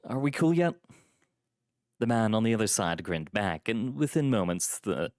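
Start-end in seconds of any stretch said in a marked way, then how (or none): 0.0-0.8 high question through a grin; grinning. A narrator's reading. Visitor ends the mock quarrel with doorman, grinning. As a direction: ask playfully, pitch lifted. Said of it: "contort into a grin when I".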